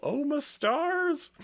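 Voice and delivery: slow, high theatrical oh my; drawn-out hammy exclamation; mock dismay. Theatrical exclamation at ruined stars.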